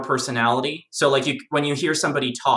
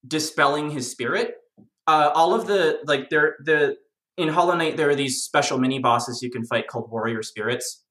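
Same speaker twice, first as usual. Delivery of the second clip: normal talking voice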